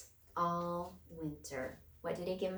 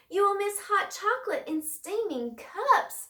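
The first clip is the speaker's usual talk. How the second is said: much higher, louder mouse voice; pleading mouse; 12 semitones higher, 7 dB louder than usual. A narrator's reading. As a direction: plead brightly like a tiny mouse.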